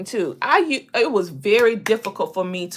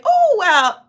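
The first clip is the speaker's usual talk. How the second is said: acted deflection; 11 semitones higher, 7 dB louder than usual. Acts out deflecting a compliment.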